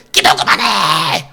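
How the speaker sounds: high, piercing imitated yell, drawn out; screamed threat. Preacher re-enacting the devil's shout interrupting his prayer, screamed threat. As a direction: scream the command as an intimidating voice. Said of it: "이렇게 소리를 지르는 거예요".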